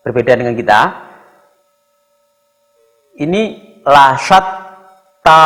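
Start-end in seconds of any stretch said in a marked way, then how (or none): 3.8-5.4 la syatta, la held very long and slow. Shown as a demonstration. Teacher to parents drawing out la syatta. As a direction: stretch la for two beats in la syatta. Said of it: "panjang dua ketukan".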